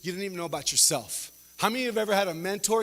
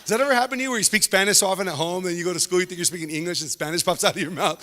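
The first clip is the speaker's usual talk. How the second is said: usual speaking voice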